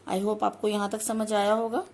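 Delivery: normal talking voice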